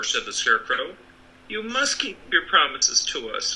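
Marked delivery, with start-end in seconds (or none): 1.5-3.5 higher, urgent exclamation; exclaiming Dorothy. A narrator's reading. Dorothy presses Oz to keep his word, exclaiming. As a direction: insist firmly, a notch higher. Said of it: "exclaimed Dorothy".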